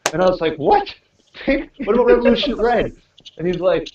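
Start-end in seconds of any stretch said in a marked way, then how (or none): 0.6-2.9 mock surprise. Retelling wine store call with friend.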